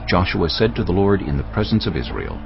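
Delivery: ordinary delivery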